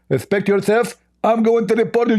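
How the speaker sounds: high, squeaky mock voice; a hammed-up high caricature voice; mocking Zakir Naik. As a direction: lampoon the opponent in a silly high pitch.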